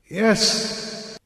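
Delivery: breathless weak yes; barely answer, worn out. A narrator's reading. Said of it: "I answered weakly".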